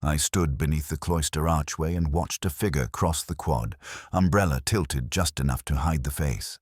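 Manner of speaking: usual speaking voice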